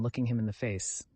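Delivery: ordinary delivery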